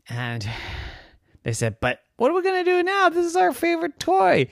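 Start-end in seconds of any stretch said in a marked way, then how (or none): 1.8-4.5 put-on high, fretful child voice; worried kid. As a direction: fret like a child over a broken treasure. Kids upset over broken toy.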